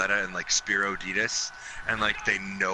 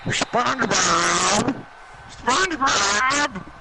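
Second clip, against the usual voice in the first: slow drawn-out yell, rising higher; loud stretched shout; 10 semitones higher, 5 dB louder, much slower than usual. Acted. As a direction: belt the name out long. Yells a silly name.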